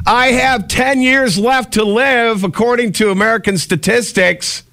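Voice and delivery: very high, drawn-out wail; hammed-up high-pitched whine, stretched out; indignant mock protest. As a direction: plead your case melodramatically. Mock protest at being teased.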